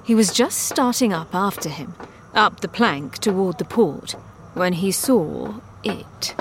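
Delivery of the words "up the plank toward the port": ordinary delivery, nothing marked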